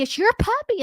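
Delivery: excited reveal. Retold or imitated. Retold surprise gift to kid.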